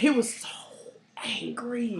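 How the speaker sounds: whispered with a pause before "angry"; emphatic whisper. Real, not acted. Telling cohost how angry he was, in a whisper. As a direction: whisper it, letting "angry" hang.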